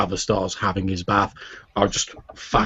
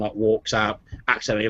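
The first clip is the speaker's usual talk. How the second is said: ordinary talk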